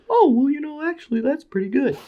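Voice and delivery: high put-on voice of an approving fan; mock fan. Imagined fan praising the packaging in a high mock voice. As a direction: squeak out a fake approving fan. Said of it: "someone goes".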